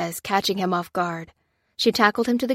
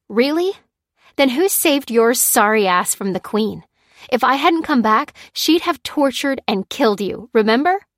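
higher, louder angry comeback; sharp retort; 5 semitones higher, 6 dB louder than usual. A narrator's reading. Lucy reminding Lopez she saved him, sharp angry retort. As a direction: fire it back at him. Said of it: "retorted Lucy".

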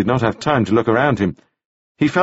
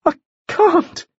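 much higher, lightly voiced woman; a hint of a frightened refusal; woman refusing a man with a pistol; Margaret refusing. A narrator's reading. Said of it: "said Missus Shepherd".

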